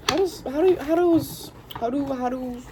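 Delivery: high, squeaky tripping over words; fumbling the question in a high squeak; squeaky stumble. Real, not acted. Tarot reader tripping over her own question, high squeak.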